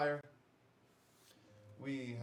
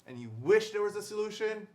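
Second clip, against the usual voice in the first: loud, higher infomercial set-up; shopping-channel host; 6 semitones higher, 7 dB louder than usual. Acted. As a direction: pose the problem like a TV pitchman. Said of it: "I feel like I'm one of those, like".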